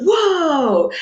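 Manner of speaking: high, loud imitation of an amazed husband; amazed exclamation. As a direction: let out a big, retold "whoa". Retold husband watching squirrels leap.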